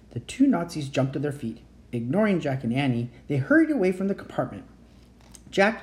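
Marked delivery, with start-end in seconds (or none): none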